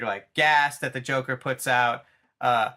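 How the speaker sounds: ordinary talk, nothing marked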